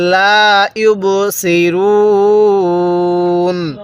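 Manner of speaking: ইবসিরুন ended on a still নুন, with the রু held three counts; teacher shows pausing at the verse end; stop on নুন. Shown as a demonstration. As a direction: stretch রু long, then stop dead on নুন. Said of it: "ওয়াকফ করলে আমি এই নুনের উপর জবরকে বাদ দিয়ে দিব".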